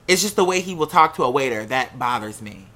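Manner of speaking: usual speaking voice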